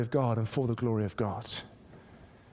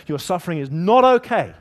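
louder, firm and insistent; emphatic declaration; 5 semitones higher, 10 dB louder than usual. Acted. God telling the suffering it matters, loud and firm. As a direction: declare it like a promise.

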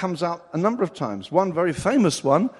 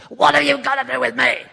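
much higher, louder hostile cry; demoniac shouting; 9 semitones higher, 8 dB louder than usual. Acted. Demoniac confronting Jesus, a loud hostile high cry. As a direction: shout it like a demon-possessed man. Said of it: "I don't think he said it like that".

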